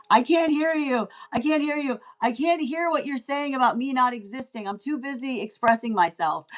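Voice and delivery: high, playful calling over a racket; pot-banging heckler. Acted. Someone banging a pot and pan, high mock calling out. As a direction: drown them out in mock obliviousness. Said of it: "banging a pot and pan going".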